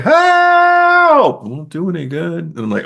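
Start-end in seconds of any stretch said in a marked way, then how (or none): 0.0-1.4 long, high, loud mock scream; imitated movie cry for help; Leslie Nielsen screaming. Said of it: "he's screaming for help". 1.4-2.4 lightly mocking, high-pitched taunt; high mock-taunting movie quote; Leslie Nielsen. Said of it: "Leslie Nielsen just goes like".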